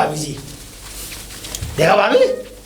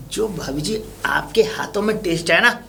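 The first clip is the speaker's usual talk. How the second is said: hushed, breathless whisper of flattery; whispered compliment; 2 semitones lower, 5 dB quieter than usual. Acted. Flatters bhabhi ji in a hushed whisper. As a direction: lean in and whisper it like a secret.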